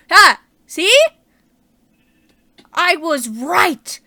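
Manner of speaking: loud, slow triumphant cry of I was right; yelling out with glee; excited shout. Real, not acted. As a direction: crow over being proven right. Finds the thing she saw.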